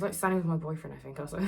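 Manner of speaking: usual speaking voice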